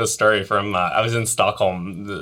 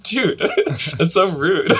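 laughing mock scold; 9 semitones higher, 4 dB louder, slower than usual. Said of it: "We're like".

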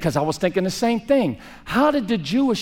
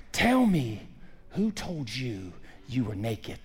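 soft, slow and hushed; gentle God voice; 8 semitones lower, 8 dB quieter, slower than usual. Acted. God gently asking Adam and Eve, soft and slow. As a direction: ask it tenderly, almost under the breath.

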